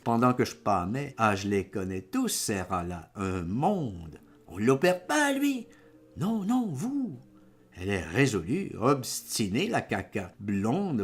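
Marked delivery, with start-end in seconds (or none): 4.5-5.7 very high, louder question; incredulous protest. A narrator's reading. Patient insists Marcel needs the operation, incredulous protest. 6.2-7.2 very high nurse voice; nurse insisting. A narrator's reading. Nurse insists to the patient, insisting. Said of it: "Elle s'adresse à moi".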